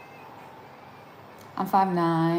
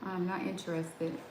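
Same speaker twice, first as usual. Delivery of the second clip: quiet dismissive mutter to herself; a soft, flat brush-off; muttered aside; 3 semitones lower, 11 dB quieter than usual. Real, not acted. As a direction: say it under your breath, unimpressed. Muttering while blocking calendar.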